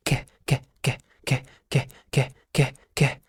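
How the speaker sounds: ك as a short "k" with barely any vowel; letter K sound. Shown as a demonstration. Teacher drilling K with sukun, short separate sounds. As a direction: clip each ك into a separate "keh". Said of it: "وتحط عليه سكون".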